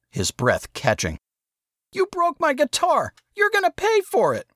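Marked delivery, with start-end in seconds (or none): 1.9-4.5 put-on high, tearful kid yell; Saul, crying out. A narrator's reading. Saul yells through tears at his brother. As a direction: cry it out like a heartbroken boy.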